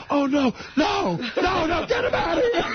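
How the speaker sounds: high, wailing mock shout of a panicked teacher; hammed-up teacher begging for rescue; Miss Fulcomer. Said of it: "My sister does an impression of her".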